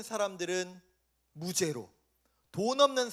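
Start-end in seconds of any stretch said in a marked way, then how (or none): none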